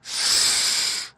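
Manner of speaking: long sharp snake hiss; hissing sss. A narrator's reading. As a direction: hiss a thin, drawn-out sss. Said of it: "the plain snake only hisses".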